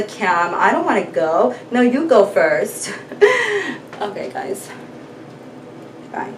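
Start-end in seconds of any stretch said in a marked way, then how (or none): 3.0-3.9 a short high falling giggle. Real, not acted. Owner giggling at her shy cat, high giggle. 4.0-6.3 breathy whisper, no voice; whispered sign-off. Real, not acted. Vlogger whispering goodbye to her viewers.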